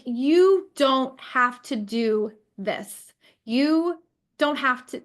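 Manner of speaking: slow, tearful, voice catching on the words; fighting back real tears while quoting the nurse; voice breaking. Real, not acted. A mother recalling the nurse who backed her, voice breaking. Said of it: "I'm getting emotional".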